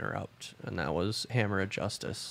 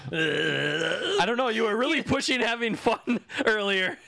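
laughing; 10 semitones higher, slower than usual. Real, not acted. Teasing through laughter.

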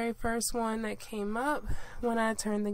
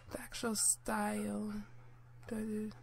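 whisper; 7 dB quieter than usual. Real, not acted. Muttering while puzzling over menu.

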